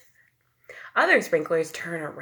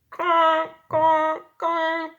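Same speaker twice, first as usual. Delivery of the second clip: high, loud, slow conk; three loud separate conks; big ducks; 11 semitones higher, 7 dB louder, much slower than usual. A narrator's reading. Teacher voices the big ducks quacking, loud. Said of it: "The big ducks say".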